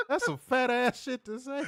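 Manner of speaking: talking through laughter; laughing. Real, not acted. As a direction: laugh while you say it.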